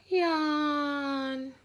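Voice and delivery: yawn. A narrator's reading. Bored duck on Friday.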